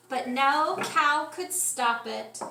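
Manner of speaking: usual speaking voice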